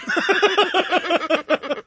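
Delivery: high, sudden laugh at the clip; burst of laughter. Real, not acted. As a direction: crack up for real. Host cracking up at clip.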